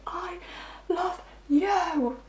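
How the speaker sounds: high, wailing soap-opera cry, starting faint; soap opera sobbing. Retold or imitated. Reader mocking the partner's tearful confession, hammed-up sob. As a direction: overplay it like a soap star in tears. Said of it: "proper, like, soap opera kind of thing".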